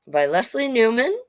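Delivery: plain reading voice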